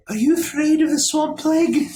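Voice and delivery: high, fast, loud questioning voice; high voice quizzing the guests; creepy host. Acted. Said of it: "he says".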